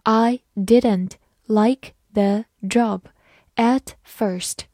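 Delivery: slow, careful read of I didn't like the job at first. Shown as a demonstration. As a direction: read slowly word by word. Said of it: "好，慢慢来读。". (慢慢地朗读)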